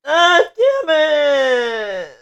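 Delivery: very high, stretched-out mock wail; drawn-out high wail. Echoing the other speaker's curse, high drawn-out wail.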